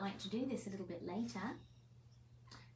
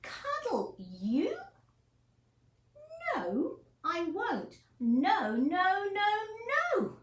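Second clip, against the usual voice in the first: slow, higher indignant refusal; stubborn refusal, louder and higher; refusing character; 10 semitones higher, 7 dB louder, slower than usual. Acted. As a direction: push him away, no-no-no. Mo refuses the hedgehog.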